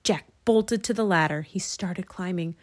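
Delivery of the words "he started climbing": ordinary delivery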